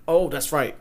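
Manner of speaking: ordinary talk, nothing marked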